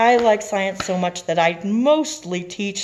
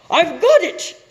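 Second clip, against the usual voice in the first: high, loud eureka shout; 14 semitones higher, 10 dB louder, slower than usual. Acted. Stickel realizing the salad dressing trick, excited shout. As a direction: burst out with the idea. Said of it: "Stickel shouted".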